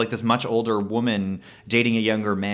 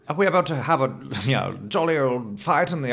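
put-on British accent, pitched up; mock British gent; 3 semitones higher, faster than usual. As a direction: play an indignant British gent, lightly hammed up. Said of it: "the British equivalent of, like, hulking out".

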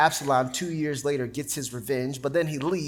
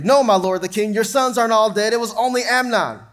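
raised, urgent messenger voice; calling out to the king; 6 semitones higher, 7 dB louder than usual. Acted. Messenger calling to the grieving king, raised and urgent. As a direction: cry the news up to the king.

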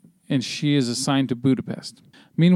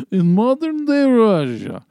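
slow, hammed-up Russian accent, much higher and louder; mock Russian trailer narrator, drawn out; 9 semitones higher, 5 dB louder, slower than usual. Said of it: "do this in a Russian accent".

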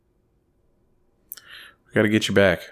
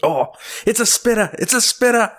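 much higher, thrilled Australian exclaim; excited Steve Irwin; 3 dB louder than usual. Retold or imitated. Comedian's Steve Irwin bit, exclaiming excitedly in an Australian accent. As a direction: burst out in Australian wildlife-host delight. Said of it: "Steve Irwin".

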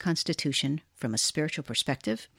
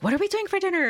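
high questioning; 14 semitones higher, faster than usual. Retold or imitated. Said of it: "evenings where we're like".

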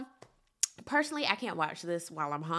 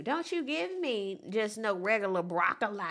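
usual speaking voice

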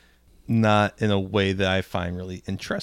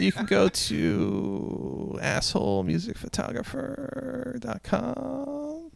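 high, soft, very slow drawl; creaky, drawn-out parody plug; put-on voice; 13 semitones higher, 7 dB quieter, slower than usual. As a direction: stretch each word in a faint, squeaky voice. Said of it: "don't do the voice".

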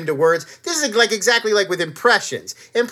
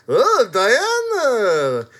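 Connie Nielsen; 4 semitones higher, much slower than usual. Acted. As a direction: lament it in a hammy Yiddish lilt. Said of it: "perfect impression of Connie Nielsen".

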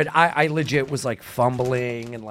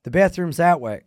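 louder, higher, in one go; all at once; 6 semitones higher, 5 dB louder than usual. Retold or imitated. Actor told to say it all at once, unbroken delivery. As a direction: say it straight through without a pause. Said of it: "No, just all at once".

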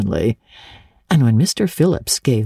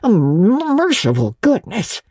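muffled exclamation; 6 semitones higher, slower than usual. A narrator's reading. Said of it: "in muffled accents".

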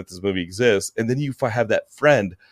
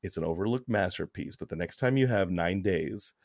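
hushed Kathy Bates menace; 4 semitones lower than usual. Retold or imitated. Friend imitated as a creepy obsessive, murmured and hushed. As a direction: lean in and murmur like an obsessed fan. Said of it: "you're Kathy Bates in Misery".

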